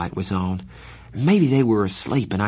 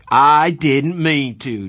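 slow, louder, higher mocking shout of I didn't mean to; 6 semitones higher, 8 dB louder, much slower than usual. Darry mocking Pony's excuse, slow loud shout. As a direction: throw his words back at him. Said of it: "Darry shouted".

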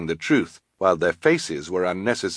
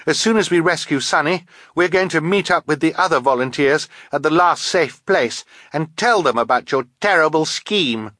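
high-pitched, defiant announcement; brave; 9 semitones higher, 4 dB louder than usual. A narrator's reading. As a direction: bravely challenge the villains. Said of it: "Violet said bravely".

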